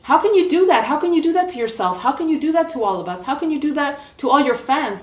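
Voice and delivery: high, heated outburst of disbelief; freaked-out coach. Acted. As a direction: blow up at the player in rapid-fire questions. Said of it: "he just freaked out".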